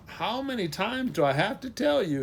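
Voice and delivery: high-pitched yawned protest; yawning. A narrator's reading. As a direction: complain through a mock yawn. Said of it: "he yawned".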